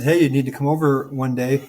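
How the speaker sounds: ordinary delivery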